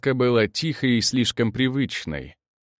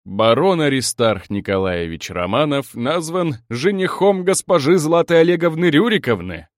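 louder, rising ceremonial TV announcer; pompous news proclamation, building higher; solemn announcement; 5 semitones higher, 5 dB louder than usual. A narrator's reading. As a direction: announce it grandly, like a newsreader. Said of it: "с торжественным видом объявила".

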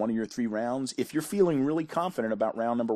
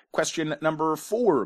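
higher, playfully big quiz-host announcement; hammed-up announcement; 5 semitones higher, 3 dB louder than usual. Quiz host introducing question four, hammed-up announcement. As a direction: announce the question with mock grandeur.

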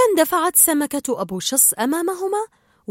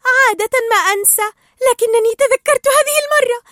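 very high, loud squeal of joy; amazed excitement; 13 semitones higher, 8 dB louder than usual. A narrator's reading. Dory remembers for once, thrilled and disbelieving. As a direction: burst out loudly, far higher, thrilled. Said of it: "ثم شهقت غير مصدقة".

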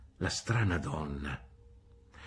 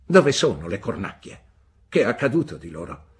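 louder, fast questions; brusque demand; 8 dB louder than usual. A narrator's reading. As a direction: demand an answer at once. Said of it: "domandò Miss Betsy".

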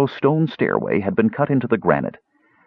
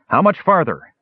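hollering; 10 semitones higher than usual. Acted. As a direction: holler down a tunnel. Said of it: "he hollered ahead to Numskull".

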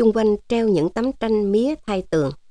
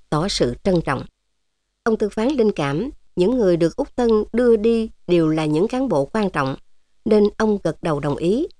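ordinary delivery

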